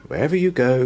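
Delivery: ordinary delivery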